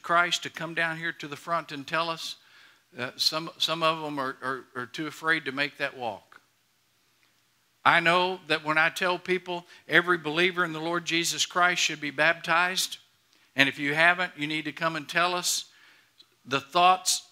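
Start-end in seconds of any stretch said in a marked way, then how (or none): none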